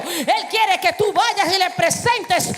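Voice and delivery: building preaching climax. Acted.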